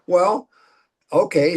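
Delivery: normal talking voice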